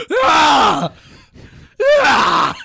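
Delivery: high, very loud victory scream; screaming in victory at a kid; triumphant yell. Retold or imitated. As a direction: roar in triumph after the hit.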